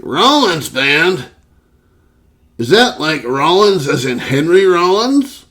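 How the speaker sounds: high, slow drawn-out doubtful question; quizzing coworker. Retold or imitated. Coworker squinting at the Rollins Band shirt, slow high mock.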